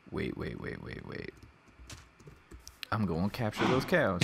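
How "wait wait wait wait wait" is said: whispered, urgent repeated waits. Real, not acted. Player creeping up to capture cows, whispering. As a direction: hush it to a whisper.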